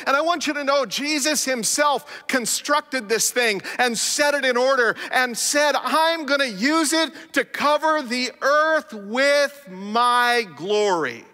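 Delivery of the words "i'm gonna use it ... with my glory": very slow, drawn-out close; preaching climax, slow and drawn; slow climactic quote. Acted. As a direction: stretch my glory out.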